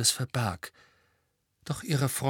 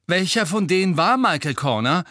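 loud, high agitated demand; 8 semitones higher, 7 dB louder than usual. A narrator's reading. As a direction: demand it, worked up. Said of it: "wollte Ron aufgebracht wissen".

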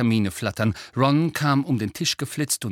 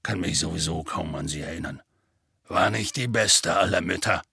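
lower, heavy giant voice, sorrowful; gruff gentle giant telling bad news; sad half-giant; 5 semitones lower than usual. A narrator's reading. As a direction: speak like big sad Hagrid. Hagrid voiced.

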